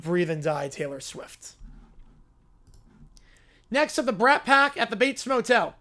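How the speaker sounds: usual speaking voice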